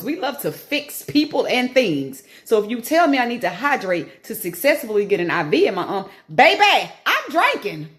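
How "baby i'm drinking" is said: put-on high, loud baby then drinking; high emphatic insistence. Shown as a demonstration. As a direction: sell the word drinking. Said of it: "Not drinking".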